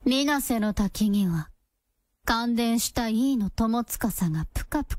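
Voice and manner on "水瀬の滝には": usual speaking voice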